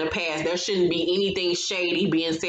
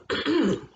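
quick throat clear; 5 semitones higher than usual. Real, not acted. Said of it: "my throat is tickling".

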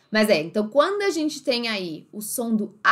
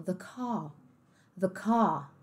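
British accent; 2 semitones lower, 7 dB quieter, much slower than usual. Shown as a demonstration. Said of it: "olha como é que eles falam".

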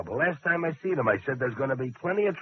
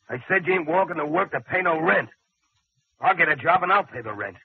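louder and higher, laying down the law; angry insistence; 6 semitones higher, 6 dB louder than usual. Acted. Husband refusing to let his wife pay the rent, angry and loud. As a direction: shut the argument down hard.

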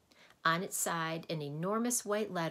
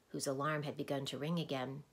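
ordinary delivery, nothing marked

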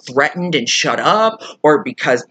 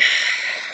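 heavy exasperated sigh; 4 dB quieter than usual. Real, not acted. Exasperated sigh mid rant.

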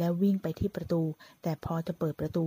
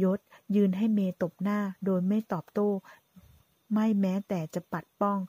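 ordinary delivery, nothing marked

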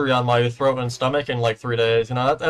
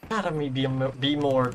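ordinary talk

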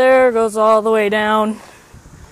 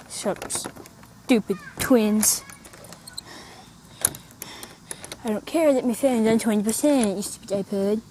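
muttering; 9 dB quieter than usual. Real, not acted. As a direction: grumble it under your breath. Grumbling at twins and iPod.

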